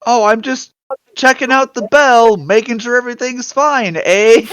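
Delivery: breezy fake Canadian accent. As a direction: bluff casually in a Canadian accent. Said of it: "I have a Canadian accent".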